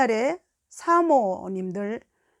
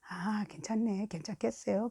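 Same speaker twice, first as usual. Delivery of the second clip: much softer, hushed reassurance; quiet retreat; 10 dB quieter than usual. Retold or imitated. Staff member laughing it off before fleeing, hushed. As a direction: drop right down, as if edging away. Retold staffer backing away.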